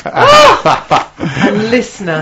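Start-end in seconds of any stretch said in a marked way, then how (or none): 0.1-1.1 loud, high mock scream; imitated shriek. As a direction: let out a big startled yelp of a noise. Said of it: "And it makes this noise".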